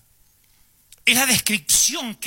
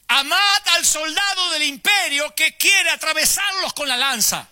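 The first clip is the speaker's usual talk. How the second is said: high, loud, slow hammering of Amad; Jesus preaching loudly; 10 semitones higher, 3 dB louder, slower than usual. Acted. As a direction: hit Amad like a command to the crowd. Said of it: "fuerte para que lo escuchara el último". (Jesús grita a la multitud en la montaña)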